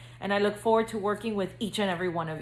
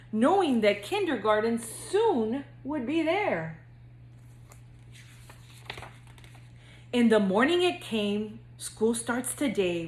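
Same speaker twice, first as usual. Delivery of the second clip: lively singsong storybook reading; reading to children; 3 semitones higher than usual. A narrator's reading. Counselor reading to future kindergartners, bouncy storybook narration. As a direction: read it like bedtime story time for little kids. Reads picture book to kindergartners.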